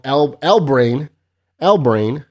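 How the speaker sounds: drawn-out, loud "El Brain" with a rising "El"; pronouncing the name. Shown as a demonstration. Host sounding out a viewer's username, loud and drawn out. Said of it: "I think it's pronounced El Brain".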